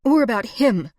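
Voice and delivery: loud sharp retort; angry. A narrator's reading. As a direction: snap it back at him.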